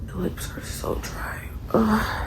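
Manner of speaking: hushed early morning whisper. Real, not acted. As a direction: whisper so nobody wakes.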